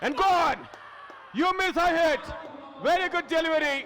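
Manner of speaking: loud, rising call of a boundary; excited commentary. Real, not acted. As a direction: belt it out as the ball flies. Boundary hit, commentator erupts.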